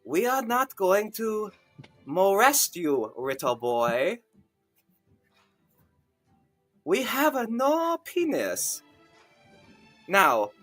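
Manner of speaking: mock Chinese accent. Eunuch reassures the frightened boy, in mock Chinese accent. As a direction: put on a broad mock Chinese accent with swooping pitch.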